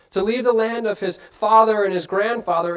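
ordinary talk

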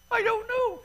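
high, put-on wife voice, lightly imitating her tears; a hint of a bawling pregnant wife; mock weepy wife. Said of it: "she'd be bawling her eyes out".

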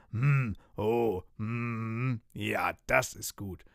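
slow, lipsmacking pirate; savouring mouthful. Acted. Pirate captain gobbles chocolate pudding, savouring slowly. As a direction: mmm through a mouthful of pudding.

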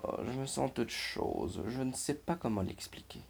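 a gentle hint of a glum robot; mopey sigh of a bored robot; sighing sad robot. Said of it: "soupira le robot".

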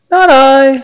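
very high, loud reindeer squeal; reindeer refusing. A narrator's reading. Reindeer refuses again in story.

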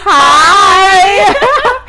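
high, loud mock greeting; loud projection. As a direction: belt the hello on purpose. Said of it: "Why do you sound so loud?".

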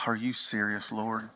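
quiet incredulous prayer aside; muttered aside to God. Retold or imitated. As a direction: mutter it under your breath at God. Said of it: "and I go".

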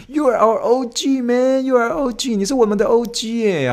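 high, stretched-out praise; hyped praise. Shown as a demonstration. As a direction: hype up an old-timer. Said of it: "你也可以跟他说".

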